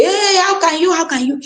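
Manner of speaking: a high, outraged hey, then fading how can you; mock exasperation. A mother mimicking her old outburst at her defiant son, in mock exasperation. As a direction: play the fed-up parent, half in jest. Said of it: "I don't go say".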